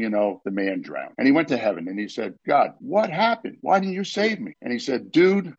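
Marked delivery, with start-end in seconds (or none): 2.5-4.5 a stunned soul asking God why; pleading drowned man. Acted. Joke's drowned man asks God why, high and pleading. 5.1-5.5 high, loud "Dude" like a Jersey guy. Acted. God answers the drowned man with a loud Jersey "Dude". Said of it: "that's how we talk in New Jersey".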